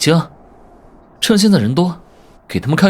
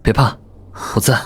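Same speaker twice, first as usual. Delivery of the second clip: low, soothing voice; tender reassurance; 7 semitones lower than usual. Acted. Hero comforting Ruyan who cannot see, tender. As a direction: comfort gently, pitch dropped low.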